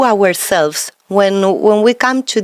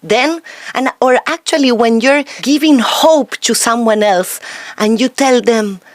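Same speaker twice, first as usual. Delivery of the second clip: usual speaking voice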